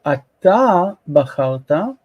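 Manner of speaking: ATA drawn out slowly before bacharta; stress on ATA. Shown as a demonstration. Teacher showing the added pronoun, stress on ATA. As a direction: stretch the end of ATA. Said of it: "that is stressing".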